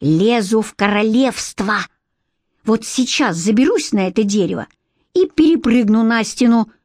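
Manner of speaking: plain reading voice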